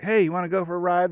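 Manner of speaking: high, bright-eyed call to the dog; excited dog owner. Acted. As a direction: pitch it way up like tempting a dog.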